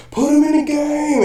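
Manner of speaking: hammed-up high yell to be put in; mock player's plea. Acted bench player calling out.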